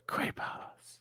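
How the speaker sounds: hushed, unvoiced single word; mock whisper. Host joins the joke, imitating the man's whisper to her. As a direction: whisper it like a secret, playing along. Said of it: "barely hear him whisper to her".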